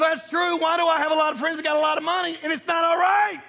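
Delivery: ordinary delivery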